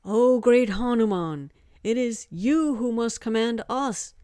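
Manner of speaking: loud, lifted ceremonial address; formal speech. A narrator's reading. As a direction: proclaim it humbly to a great god. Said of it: "flabbergasted at his formal speech".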